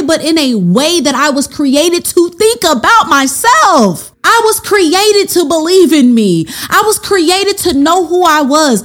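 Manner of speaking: carried away, preaching it with real excitement; getting excited. Real, not acted. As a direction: build with rising fervor and conviction. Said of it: "I'm getting ex-, I'm getting excited".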